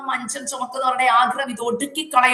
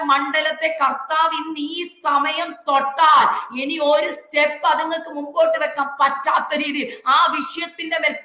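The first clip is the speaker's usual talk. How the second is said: high, sustained revival preaching; urgent, high-pitched sermon climax; fervent preaching; 4 semitones higher than usual. Real, not acted. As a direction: drive each phrase home to the congregation. Preaches at full force.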